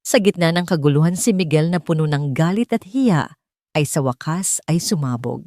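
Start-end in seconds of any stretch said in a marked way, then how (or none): none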